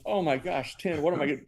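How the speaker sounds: high-pitched retold dismay, no real crying; tearful dismay. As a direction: replay being overwhelmed by bad news. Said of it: "I'm in tears. I'm going".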